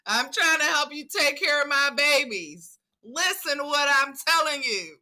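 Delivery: playful, insistent telling-off; not playing. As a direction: imitate an exasperated sister laying down the law. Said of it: "Sister was not playing".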